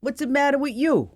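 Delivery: put-on brash high New York accent; a New Yorker snapping back, New York accent. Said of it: "like, a New Yorker Siri?".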